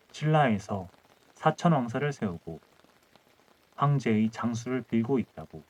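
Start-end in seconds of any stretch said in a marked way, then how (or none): none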